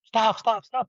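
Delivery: high, loud retold shout of stop; troopers yelling stop. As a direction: bark the order like a trooper chasing him. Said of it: "Troopers are like".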